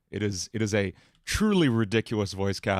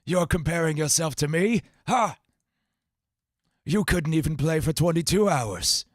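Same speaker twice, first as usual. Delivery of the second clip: a lightly voiced, higher, scornful rival; Shadow the Hedgehog; 5 semitones higher than usual. Taunting a copycat, lightly voiced edgy hedgehog. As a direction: sneer at a wannabe rival. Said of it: "Shadow the Hedgehog".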